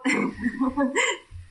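high, breaking giggle; burst of laughter. Real, not acted. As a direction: laugh escapes mid-sentence. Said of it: "No te rías".

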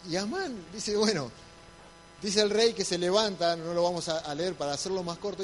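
usual speaking voice

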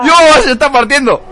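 shouting with delight; loud, thrilled outburst; excited. Real, not acted. Commentator reacts to laughter.

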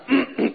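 short, self-important "ahem"; mock throat clearing. Doctor facing five guests at orientation, pompous throat clearing. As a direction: clear the throat before a grand address. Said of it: "él se para acá así".

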